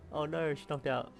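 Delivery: ordinary talk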